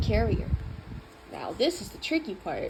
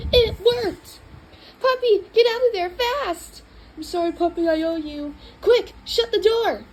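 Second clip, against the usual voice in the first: much higher, louder urgent shouting; frantic hurry; 7 semitones higher, 7 dB louder than usual. Acted. Owner realising the puppy is trapped, high urgent calls. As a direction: scramble to fix the mix-up. Wrong animal in the carrier.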